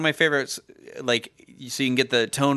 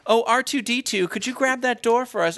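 much higher, sing-song royal request; prissy princess voice; 10 semitones higher than usual. Retold or imitated. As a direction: ask the butler sweetly like a princess. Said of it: "they're like".

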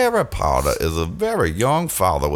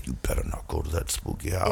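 hushed warning; 6 semitones lower, 11 dB quieter, faster than usual. Retold or imitated. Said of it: "And says".

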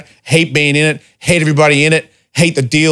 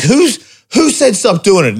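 high, sharp mock indignation; retold outrage, pitched up; indignant retort; 8 semitones higher than usual. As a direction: demand to know who said it. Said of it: "I'm like".

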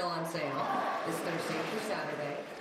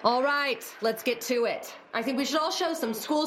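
rallying; 5 semitones higher, 6 dB louder than usual. Acted. Principal rallying the assembly.

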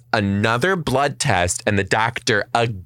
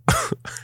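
real high-pitched squeal of laughter; 3 dB quieter than usual. Real, not acted. Laughing while telling the HIV story to Mary, a high squeal.

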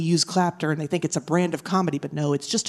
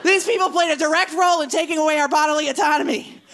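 high, loud comic outrage; exasperated comic rant; 12 semitones higher, 4 dB louder than usual. Acted. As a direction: rant at a high pitch, worked up.

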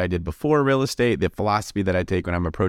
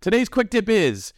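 put-on old English; 11 semitones higher than usual. Shown as a demonstration. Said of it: "Now you have to say it in an old English accent".

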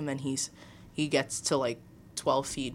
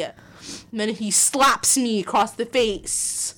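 normal talking voice

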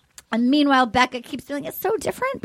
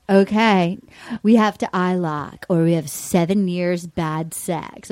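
imitated Becca; 9 semitones lower than usual. Quoting Becca on the date.

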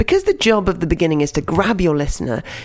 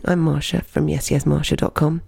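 ordinary delivery

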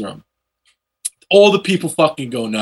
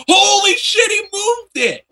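amazed shout; slower than usual. Retold or imitated. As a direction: shout it like a stunned crowd.

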